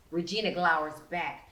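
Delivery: ordinary delivery, nothing marked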